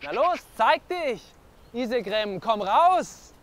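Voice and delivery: high-pitched shout to lure something out; calling out loudly. Acted. Man calling a hidden wolf out of the woods, shouting. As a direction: yell into the woods, drawing out the call. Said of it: "wenn du so schreist".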